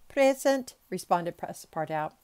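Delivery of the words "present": high, loud response; roll-call answer. A narrator's reading. Servant answering the judge's summons, calling out. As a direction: pipe up smartly to be counted.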